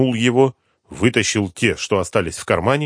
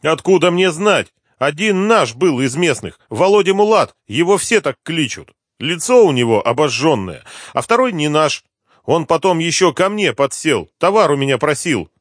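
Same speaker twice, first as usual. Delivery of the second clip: higher-pitched voice of a pleading snitch; anxious addict informant; 9 semitones higher, 6 dB louder than usual. Acted. Addict tells the inspector about hidden bodies, high insistent voice. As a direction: play the informant eager to be believed.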